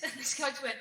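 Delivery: normal talking voice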